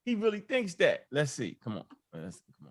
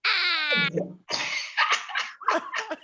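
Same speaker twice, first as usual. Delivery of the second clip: fool voice cackle; 12 semitones higher than usual. Acted. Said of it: "I'ma put my goddamn fool voice".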